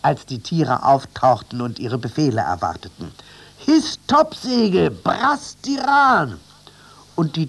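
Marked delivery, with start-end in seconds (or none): 3.6-6.4 high, loud and slow; shouting over the storm. A narrator's reading. First mate ordering the animals over the storm, high slow shout. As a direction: bellow the sail orders into the wind. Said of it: "überschrie den Sturm".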